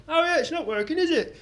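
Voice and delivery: lightly hammed-up careless manager; imitating loud bar manager. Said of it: "dead loud while the compere was on".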